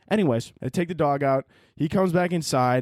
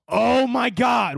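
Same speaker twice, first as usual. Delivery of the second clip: higher, louder, stretched out; exasperated oh my God; 9 semitones higher, 5 dB louder, much slower than usual. Real, not acted. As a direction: groan it out as if it's hopeless. Dog fighting his mirror reflection.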